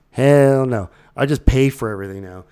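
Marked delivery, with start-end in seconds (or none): 0.1-0.9 louder, higher punch on hell; emphatic hell no. Real, not acted. Host refusing pirated streaming boxes, louder emphatic no. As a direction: slam the refusal.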